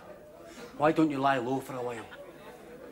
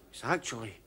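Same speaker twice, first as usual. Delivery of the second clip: mock-solemn hush; 6 semitones lower, 8 dB quieter than usual. Mock-solemn religious claim in pub.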